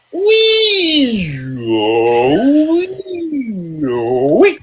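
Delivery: range siren. Shown as a demonstration. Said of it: "this is what you need to do, is go".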